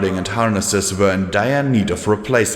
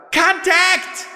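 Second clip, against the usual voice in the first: screamed alarm. A narrator's reading. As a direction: scream the warning into the night. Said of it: "A mare screamed in the night".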